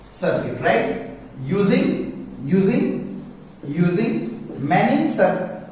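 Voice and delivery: very slow dictation, chunk by chunk; slow classroom dictation with long pauses; dictating. Real, not acted. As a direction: dictate for note-takers, drawing out and repeating words. Teacher dictating a definition for notes.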